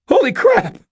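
very high, loud startled yell; shouted shock. Acted. Cashier shouts in shock as the bottles burst. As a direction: cry out at the shattering glass. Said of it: "shouted the cashier".

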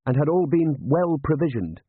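hint politely at what is missing; meaningful. A narrator's reading. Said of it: "he added meaningfully".

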